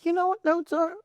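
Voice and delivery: high-pitched mocking teacher's question; condescending teacher. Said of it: "high school teacher".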